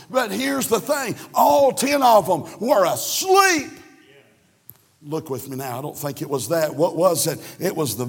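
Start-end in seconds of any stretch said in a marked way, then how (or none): 0.1-3.7 fiery preaching. Acted. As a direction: preach it out over the congregation. Preaching at full force.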